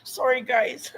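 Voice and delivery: choked, high, faint tearful voice; crying. Real, not acted. Streamer apologizing to viewers for being emotional, crying. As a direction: squeeze the words out through real tears. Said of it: "I'm such an emotional person".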